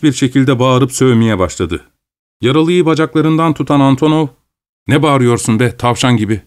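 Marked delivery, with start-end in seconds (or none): none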